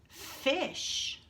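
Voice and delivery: "Fish" breathed out unvoiced; whispered word. Shown as a demonstration. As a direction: whisper "Fish" to stress the sounds. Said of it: "Let's make the sound".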